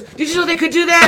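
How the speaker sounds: laughing, high-pitched astonished mimicry; excited imitation. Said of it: "she goes".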